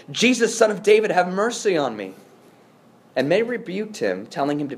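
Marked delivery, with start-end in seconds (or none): 0.1-2.1 raised, higher-pitched plea for mercy; crying out. Acted. Blind beggar calling to Jesus as he passes, crying out. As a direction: call out in earnest to someone passing. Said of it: "he began to cry out and say".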